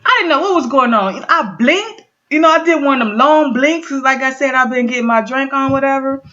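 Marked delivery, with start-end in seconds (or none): none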